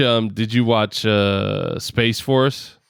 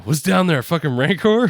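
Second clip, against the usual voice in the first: James Franco's movie line; 7 semitones higher than usual. Retold or imitated. Co-host quoting a favorite comedy line, pitched-up impression. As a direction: quote the film line, pitched up. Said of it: "James Franco's Rancor line in Pineapple".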